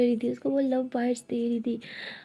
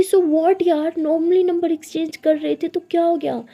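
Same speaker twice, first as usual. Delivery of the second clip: loud, higher defiant protest; heated defence; 5 semitones higher, 7 dB louder than usual. Acted. Siya defends swapping numbers with the girl, loud defiant protest. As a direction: push back hard, raising the voice.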